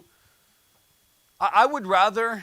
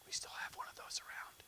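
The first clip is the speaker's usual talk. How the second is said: a dry whispered aside, breath only; 20 dB quieter than usual. Real, not acted. Preacher whispering a wry aside to the congregation. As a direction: slip it in under your breath as a joke.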